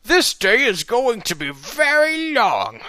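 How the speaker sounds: slow, loud hammed-up reading; mock dramatic line. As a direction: ham up the last line. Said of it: "weird end to a chapter".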